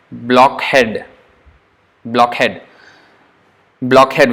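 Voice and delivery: blockhead with the k running into a breathy h; blockhead aspiration demo. Shown as a demonstration. Teacher using blockhead to show kha. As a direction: stress the k-h join in blockhead. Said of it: "It's like K and H".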